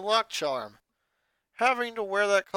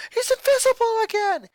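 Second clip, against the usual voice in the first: much higher, loud alarmed exclamation; panicked cry; 9 dB louder than usual. A narrator's reading. Lenny panics at empty room.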